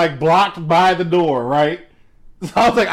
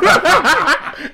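real high-pitched burst of laughter; 12 semitones higher than usual. Real, not acted. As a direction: crack up helplessly. Laughs at the useless rep.

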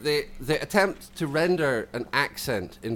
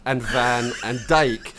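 ordinary delivery

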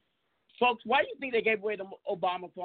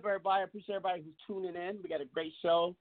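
ordinary delivery

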